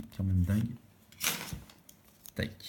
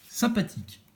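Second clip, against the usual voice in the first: much higher, sing-song Sympathique; 12 semitones higher than usual. Shown as a demonstration. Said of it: "said twice, first high".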